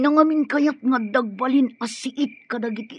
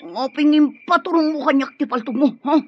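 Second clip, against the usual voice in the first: ordinary talk, nothing marked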